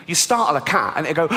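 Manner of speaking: normal talking voice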